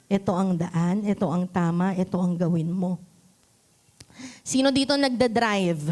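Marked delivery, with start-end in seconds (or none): 0.1-3.0 calm voice leading the way; gentle guidance. Acted. Echoing the gentle whisper.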